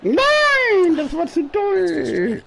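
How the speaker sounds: high, drawn-out groan of dismay; dismayed cry. Real, not acted. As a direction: wail it slowly, can't believe it. Bad shot in golf game.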